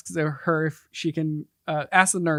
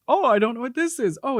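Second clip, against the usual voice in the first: higher, mock-puzzled voice; imitating movie heroine; 6 semitones higher than usual. Co-host mimics heroine finding a dust ball, higher puzzled voice. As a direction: play her naive puzzlement, pitched up. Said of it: "And she just says".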